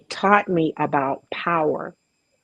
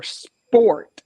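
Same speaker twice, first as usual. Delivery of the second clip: "sport" punched, higher and louder; stress on SPORT; 5 semitones higher, 4 dB louder than usual. Shown as a demonstration. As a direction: lean hard on "sport" and let it fall. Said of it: "and I emphasize the word sport".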